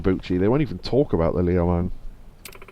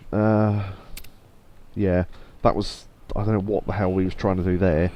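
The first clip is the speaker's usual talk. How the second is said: ordinary talk, nothing marked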